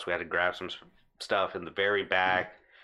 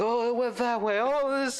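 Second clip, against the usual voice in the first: shrill cartoon panic, much higher; panicked high squeal. Big guy fleeing the cat, high panicked squeal. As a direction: shriek like you're running from a mouse.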